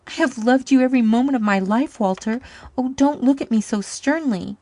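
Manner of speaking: high, loud, tearful plea; tearful confession. Acted. Woman tearfully confessing lifelong love. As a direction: beg him not to look so stern.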